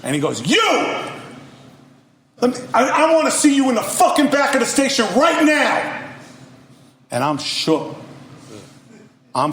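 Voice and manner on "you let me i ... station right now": high, booming acted-out yell; angry boss shout. Station boss ordering rapper out back, angry shout. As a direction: bellow it like an enraged station boss. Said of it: "like a mixture between Jim Brown and Don King".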